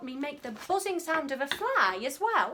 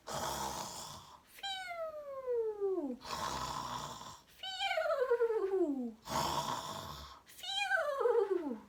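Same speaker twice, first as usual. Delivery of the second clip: sleepy snoring breath; 9 semitones higher, 7 dB quieter, much slower than usual. Acted. Lion snoring in his sleep.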